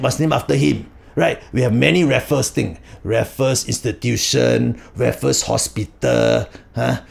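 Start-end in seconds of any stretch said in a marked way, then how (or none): none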